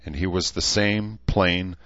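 ordinary delivery

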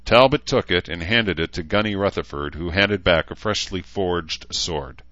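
plain reading voice

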